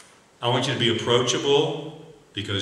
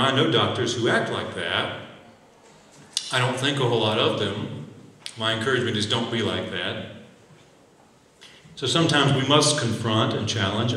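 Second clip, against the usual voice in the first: ordinary talk, nothing marked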